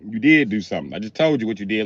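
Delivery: ordinary talk, nothing marked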